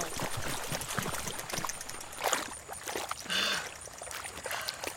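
long, soft, voiceless panting; exhausted, drawn-out breaths; winded sighing. Acted. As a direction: catch your breath after a chase. Giving up after failed chase.

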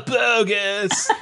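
stoner. Pretend. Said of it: "this should actually just be your character".

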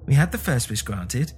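plain reading voice

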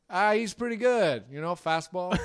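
high, loud impression of a cheery ballplayer; Fernando Tatis. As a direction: answer like an upbeat mic'd-up athlete. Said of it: "when they're all mic'd up".

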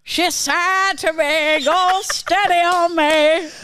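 put-on very slow, high copycat British Isles accent; parroted British Isles accent. Parroting the line just heard, exaggerated British Isles accent. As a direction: echo the regional accent, stretched right out. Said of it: "the parroting is better".

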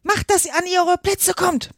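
high-pitched queen's order; thundering command. A narrator's reading. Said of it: "donnerte die Königin". (donnernder Befehl, hohe Stimme)

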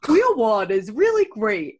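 loud, slow "Real World" with W's for R's; hammed-up speech impediment. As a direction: belt out "Real World" as a joking "Weal Wold". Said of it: "this is hard for my speech impediment".

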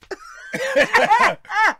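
shrieking burst of laughter; loud high laugh. Real, not acted. Laughing at his co-host's dead accent, shrieking laugh.

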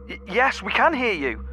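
high, stammered call; calling back. Acted. Answers the voice in the rift.

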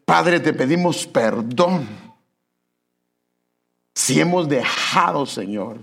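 slow pleading prayer to the Lord; earnest prayer. Acted. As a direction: pray it earnestly for the congregation. Leads closing prayer. (oración sincera y suplicante)

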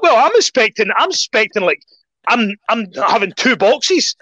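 high, indignant retelling; mock outrage at a tiny order; exasperated protest. Retold complaint about small portion.

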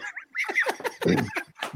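high, breathy burst of laughter; real laugh. Real, not acted. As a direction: laugh out at your own joke. Laughing at own bald story.

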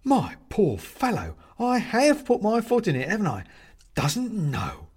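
high, drawn-out dismay; aghast. A narrator's reading. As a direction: exclaim it, appalled at your blunder.

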